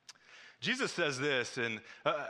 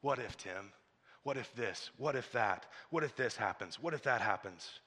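voice of fear; 6 semitones lower, 5 dB quieter than usual. Acted. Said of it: "the voice of fear always says".

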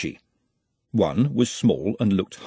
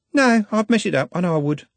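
put-on higher, eager girl's voice; young girl Juliet; 9 semitones higher than usual. Juliet insists to Glenda, high and eager. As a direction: voice her as a chatty young girl.

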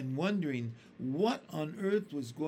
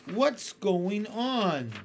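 alarmed cry; 5 semitones higher, 5 dB louder, much slower than usual. A narrator's reading. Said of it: "cried James".

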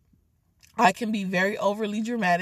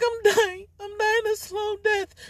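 mock dying whine; 10 semitones higher than usual. Melodramatic self-pity.

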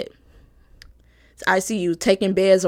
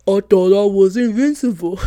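laughing put-on dopey teen voice, louder; mock her brother with a goofy laugh in it; brother's voice; 3 semitones lower, 6 dB louder than usual. Sister mocking her brother's excuse in his voice. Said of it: "let me do my brother's voice".

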